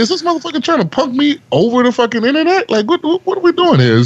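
very high-pitched mock outrage; retold indignation. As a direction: squeal your disbelief as you retell it. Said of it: "I was like".

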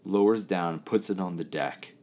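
ordinary talk, nothing marked

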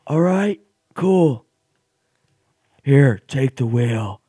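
high, loud laid-back surfer dude voice; Keanu Reeves surfer voice. Retold or imitated. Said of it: "he's got that surfer voice".

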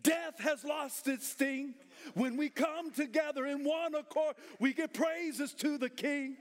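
high, drawn-out preacher's shout; full-force preaching. Real, not acted. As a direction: preach it to the rafters, stretching the phrases.

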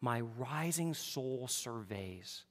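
slow, reverent hymn reading; a slow recited hymn verse. Acted. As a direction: savour each line slowly. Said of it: "one author says it like this".

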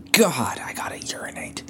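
urgent whispered aside; real whispered urgency to pee. Real, not acted. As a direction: breathe it out under the breath. Sudden urge mid podcast.

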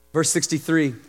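ordinary talk

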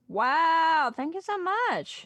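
delighted thank-you for the gifted subs; excited thanks. Real, not acted. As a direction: really gush over the gift.

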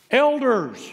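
high, loud exclaimed ELDERS; exclaiming. Acted. Peter summoning the church elders by his first word, exclaimed. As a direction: call out "Elders" to seize attention. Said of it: "just comes right out and just exclaims".

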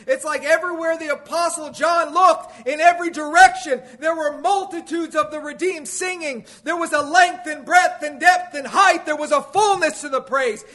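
high, loud pulpit proclamation; impassioned preaching. Real, not acted. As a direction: build to a ringing, driving sermon peak. Preaches at full force.

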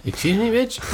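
high, camp "excuse me"; mock-offended diva voice; sassy outrage.

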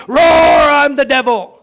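loud roaring devil voice. Acted. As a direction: bellow as the devil. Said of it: "It might be".

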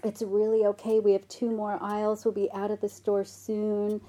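soft, soothing mother voice; calm a crying child kindly; tender reassurance, slowing at the end; gentle, kind mom. Retold or imitated. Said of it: "very gently and kindly says".

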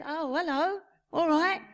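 high, cheery mimicked hello; imitated friendly greeters. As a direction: greet like smiling passers-by. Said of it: "everybody says, smiles and says".

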